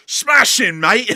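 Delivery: loud, high British cartoon shout; British cartoon yell. Narrator imitates a British cartoon man, loud British shout. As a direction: bellow it like a rowdy British cartoon character. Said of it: "British cartoon who goes".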